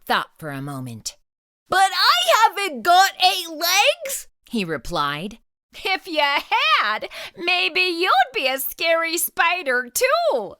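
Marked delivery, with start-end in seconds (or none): 1.7-4.3 kitten voice. Acted. Splat's voice in story. 5.8-10.6 teasing. Acted. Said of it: "teased his mom".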